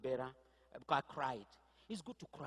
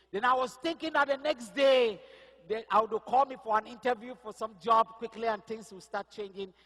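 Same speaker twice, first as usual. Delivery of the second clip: higher, louder preaching drive; preaching testimony; 6 semitones higher, 8 dB louder than usual. Acted. Pastor telling the church his job struggle, preaching voice. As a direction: lift the story into full sermon force.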